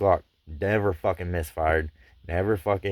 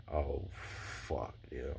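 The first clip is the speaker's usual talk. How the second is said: muttered curse under breath; 4 semitones lower, 14 dB quieter, much slower than usual. Retold or imitated. Dad missing, friend hangs up.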